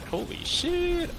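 high, slow stretched-out amazement; amazed holy shit. Real, not acted. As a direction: drag it out in awe. Sees many enemies.

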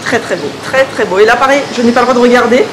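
muffled behind mask; speaking masked indoors; through a face mask. Real, not acted. Seen 1: Selfie speaker wears a cloth face mask over mouth and nose.

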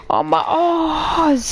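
mock sorrow. Lament over ores.

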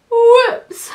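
high, loud exclamation; startled whoops. A narrator's reading. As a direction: blurt it as something slips. Hat slips off.

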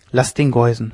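plain reading voice

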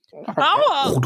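high, sudden yelp; cry of pain. Acted. Louis with rubble falling on his feet, pained yelp. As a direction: yelp it out as if hurt. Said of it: "machte Louis".

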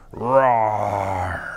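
a low mock lion roar. Shown as a demonstration. Teacher acting the lion for the children, mock roar. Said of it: "a lion roaring".

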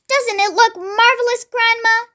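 put-on high, delighted boy's call; excited shout. A narrator's reading. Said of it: "George shouted".

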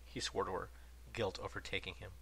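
usual speaking voice

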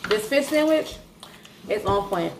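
talking while biting food; eating wing mid-sentence; mouth full, chewing. Real, not acted. Seen 0: Holds wing, bites it at mouth; it's chicken wings, not a sandwich.

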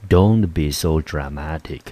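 "Don't be so dramatic" said slowly and deliberately; slow it down so learners can copy; slow careful repeat. Shown as a demonstration. Teacher repeats the phrase slowly for students to copy.

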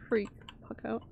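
whispered admission; whispered confession. Real, not acted. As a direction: drop to a whisper. Admits fear, trails off.

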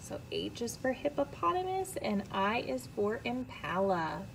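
usual speaking voice

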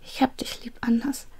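soft, high, quivering whisper; a girl whispering farewell to her friend; trembling voice. Acted. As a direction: say goodbye through tears. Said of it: "Ihre Stimme bebte".

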